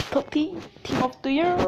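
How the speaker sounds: "Team of the Year" in a clumsy French-tinged English accent; awkward English accent on "Totti, Team of the Year", rising at the end. Shown as a demonstration. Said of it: "Désolé pour mon accent anglais".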